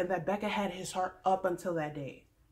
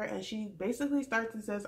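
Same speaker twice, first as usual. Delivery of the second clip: ordinary talk, nothing marked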